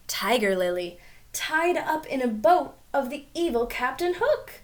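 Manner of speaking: usual speaking voice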